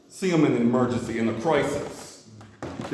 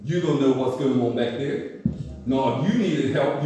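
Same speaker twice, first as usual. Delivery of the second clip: ordinary talk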